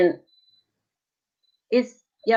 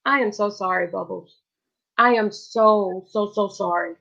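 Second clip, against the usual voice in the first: tender comfort. Real, not acted. Consoling a crying viewer.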